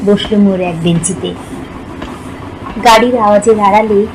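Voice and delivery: usual speaking voice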